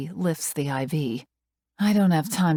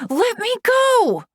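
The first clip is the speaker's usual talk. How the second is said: high, slow, much louder scream; 7 dB louder, slower than usual. A narrator's reading. Screaming at Joker to be let go, desperate. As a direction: scream it out in pain. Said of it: "I scream".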